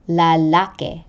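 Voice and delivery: lalaki with stress on the middle -la-; penultimate stress. Shown as a demonstration. Teacher repeating a penultimate example, middle syllable stressed in lalaki. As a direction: lean on the second syllable of lalaki. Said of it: "given to the syllable before the last".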